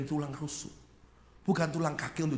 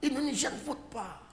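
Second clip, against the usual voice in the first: high-pitched wifely request; pleading wife; 8 semitones higher than usual. Acted. Wife asks husband for Indonesian food, high-pitched pleading. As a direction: call out high, then drop on the last word. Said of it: "istrinya ngomong".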